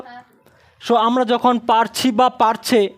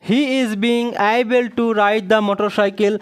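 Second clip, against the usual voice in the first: slow dictation; much slower than usual. Shown as a demonstration. Teacher dictating the answer slowly.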